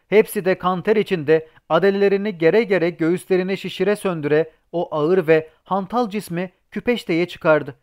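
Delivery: plain reading voice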